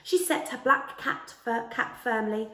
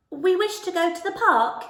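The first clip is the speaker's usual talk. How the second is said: higher, louder hedgehog voice; eager high hedgehog; little hedgehog voice; 8 semitones higher, 6 dB louder than usual. A narrator's reading. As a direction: pipe up bravely as a small hedgehog. Max the hedgehog speaks.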